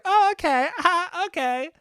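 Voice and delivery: very high, chirpy mock-mom voice; put-on high mom voice with a little laugh; mom chatting. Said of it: "And mom's like".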